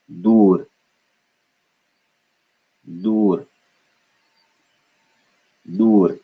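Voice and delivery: Dur with a plain d, no puff; one airless syllable. Shown as a demonstration. Teacher modelling for students, Dur in one syllable without air. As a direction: say Dur as a single isolated syllable. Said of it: "we combine it into one syllable".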